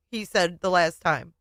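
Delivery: usual speaking voice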